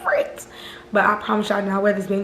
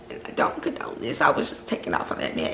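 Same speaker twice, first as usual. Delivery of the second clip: lower, softer whispered confession; whispered aside; 10 semitones lower, 7 dB quieter than usual. Real, not acted. Admitting to viewers she hit him, whispered aside.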